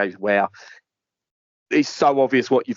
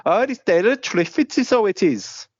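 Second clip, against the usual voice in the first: high, mock Welsh lilt; sing-song mock Welsh delivery up high; hammed-up Welsh accent; 9 semitones higher than usual. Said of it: "Very Welsh".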